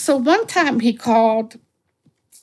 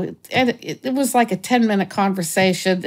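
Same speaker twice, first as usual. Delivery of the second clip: ordinary delivery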